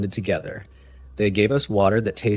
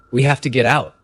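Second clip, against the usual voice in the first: louder, higher, urgent line; urgent resolve; 5 semitones higher, 7 dB louder than usual. A narrator's reading. Captive telling cellmates they must escape, urgent resolve. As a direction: urge them to act now.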